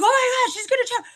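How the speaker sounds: put-on high, loud alarmed gasp of an old man; imitating a panicked grandfather; freaked out. Grandfather panics that granddaughter will choke, high alarm. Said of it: "he freaked out".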